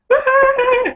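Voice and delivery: very high screamed wail; mock frantic scream. People fleeing the funnel, mock frantic scream. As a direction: imitate a crowd screaming in panic. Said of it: "people start running and screaming frantically".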